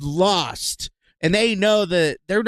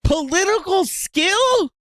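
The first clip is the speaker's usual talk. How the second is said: mocking echo; 10 semitones higher, slower than usual. Real, not acted. Mocking quote of clip.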